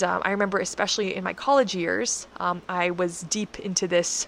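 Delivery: normal talking voice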